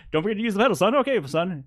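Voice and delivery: high, loud, pepped-up coaching; hyped character voice, pitched high; Vernon. Acted. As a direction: instruct like you're wildly over-caffeinated. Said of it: "he peps way the fuck up".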